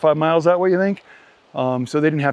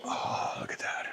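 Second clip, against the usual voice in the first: whispering over a crib; 11 semitones higher, 13 dB quieter, slower than usual. Real, not acted. Baby sleeping nearby.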